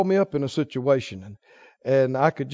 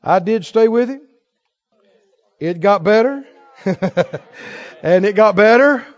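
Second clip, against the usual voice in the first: usual speaking voice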